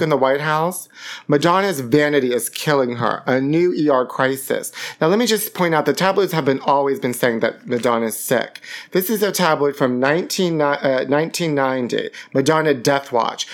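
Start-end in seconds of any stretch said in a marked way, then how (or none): none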